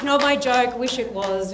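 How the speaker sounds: usual speaking voice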